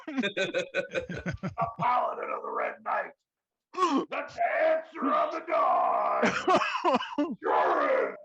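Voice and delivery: booming ring announcer, drawn-out shout; wrestling promo. Acted. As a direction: hype the champion like a ring announcer. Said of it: "let me tell you how to cut a promo".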